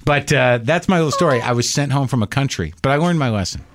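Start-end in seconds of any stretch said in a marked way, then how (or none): none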